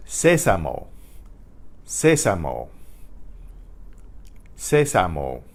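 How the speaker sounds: sésamo said SE-sa-mo; Spanish sésamo, weight on SÉ; first-syllable stress. Shown as a demonstration. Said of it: "with an accent on the first".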